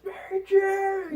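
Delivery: very high, loud, drawn-out wail; mock anguished cry. Mimicking Spider-Man every five minutes, high drawn-out mock cry. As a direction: stretch the name into a hammed-up yell.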